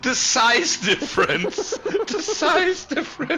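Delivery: high squealing laugh; laughing shriek. Real, not acted. Laughing at tiny car.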